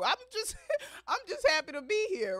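high, cracking laugh-cry; a laugh that nearly breaks into tears; laughing half crying. Real, not acted. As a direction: laugh through the hurt, voice up high. Laughing through painful memory.

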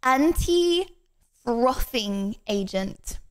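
very slow, drawn-out naming of the ingredient; pause, then stretch out each syllable; deliberate, incredulous list item; dramatic reveal. Real, not acted.

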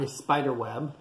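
usual speaking voice

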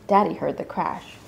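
usual speaking voice

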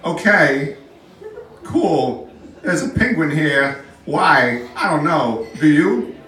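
halting impression that slips slightly cartoonish; short, separate phrases with odd pauses; Christopher Walken. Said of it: "I kinda sound like King Candy".

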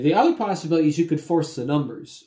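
normal talking voice

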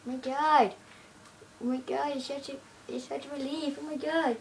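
high, loud relief; relieved exclamation. Real, not acted. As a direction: let out a big sigh of relief. Relief after a tense moment.

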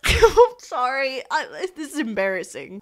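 embarrassed laughing apology. Real, not acted. Laughs at own attempt.

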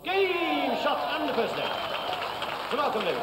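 darts score caller. Real, not acted. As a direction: stretch it out like a darts referee. Caller announcing the game shot.

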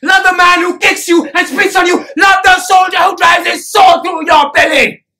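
loud, relentless exhortation; full-voiced sermon at fever pitch; impassioned preaching. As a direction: thunder the commands at the crowd. Preaching a hard command to crowd.

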